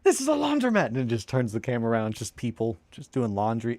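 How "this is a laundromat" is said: very high, loud shocked squeal; cartoon quote. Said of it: "And it's just".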